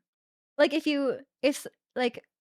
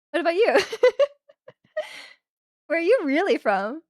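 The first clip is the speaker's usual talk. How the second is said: higher, louder question tossed back with a laugh; pointed comeback; 5 semitones higher, 4 dB louder than usual. Acted. Turning the origins question back on whoever asked, playfully pointed.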